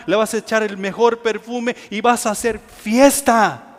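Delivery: ordinary talk, nothing marked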